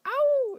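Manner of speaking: very high, loud mock wolf howl; imitated howl. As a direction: howl like a pack of bullies. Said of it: "howling and shit".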